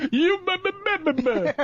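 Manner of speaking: high mock stammer; a sputtering complaining boyfriend, lightly; mocking whiny guy. Mocking guys who whine at their girlfriends, stammering. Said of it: "guys that say".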